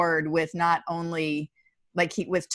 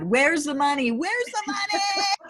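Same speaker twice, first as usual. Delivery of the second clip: mock scream; 11 semitones higher than usual. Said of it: "started screaming to God".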